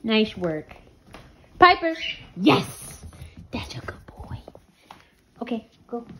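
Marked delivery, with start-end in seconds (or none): 2.4-6.1 whispering. Real, not acted. Whispered praise to dog.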